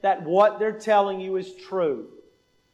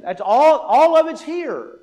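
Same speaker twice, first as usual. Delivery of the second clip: emphatic preaching; 5 semitones higher, 7 dB louder than usual. Real, not acted.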